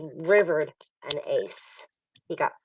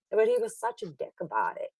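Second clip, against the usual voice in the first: ordinary talk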